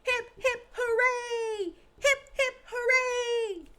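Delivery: very high, loud crowd cheer; hip hip hooray. Acted. The meeting cheering Little Miss Shy, very high and loud. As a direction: shout the cheer joyfully. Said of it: "everyone cried".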